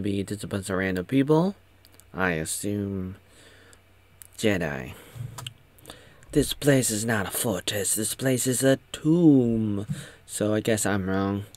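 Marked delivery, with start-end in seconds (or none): 6.3-9.9 put-on dramatic film voice; solemn quoted line. Kenobi reveals the fortress is a tomb, mock solemn. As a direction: draw out the last word for mock gravity.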